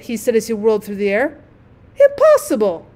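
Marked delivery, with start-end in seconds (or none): 2.0-2.9 much higher, louder scandalised cry; outraged disbelief. A narrator's reading. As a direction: refuse to believe the insult. Rocket outraged.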